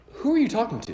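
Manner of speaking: high, startled question; a high, bewildered who-me question; incredulous. Retold or imitated. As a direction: ask it in disbelief, voice jumping up. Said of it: "And I was like".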